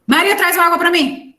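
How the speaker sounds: calling Maria high and loud; near shout. Shown as a demonstration. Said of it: "isso é completamente diferente".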